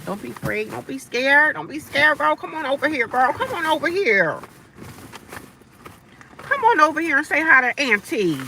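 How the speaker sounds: high, loud sing-song coaxing; coaxing auntie voice. Acted. Auntie coaxing shy new viewers to come say hi, high and sing-song. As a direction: call them in like a cooing auntie.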